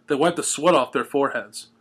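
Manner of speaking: usual speaking voice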